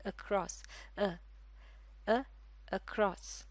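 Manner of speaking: across with a soft, short schwa; schwa demo in across. Shown as a demonstration. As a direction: softly model the अ in across. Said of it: "ये आवाज़ है अ की आवाज़".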